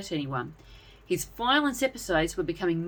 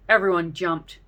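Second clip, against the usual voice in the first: ordinary delivery, nothing marked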